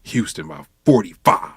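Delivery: imitating a devout player's vow; mock confident player. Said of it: "he said".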